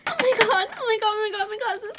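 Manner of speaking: loud, high, gushing excitement; thrilled. Acted. Girl thanks mom for the glasses, thrilled. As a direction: gush over the gift.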